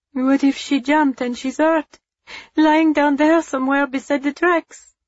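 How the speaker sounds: put-on high, anxious boy's voice; worried child fearing the worst; young and lost. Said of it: "He sounded so young and lost".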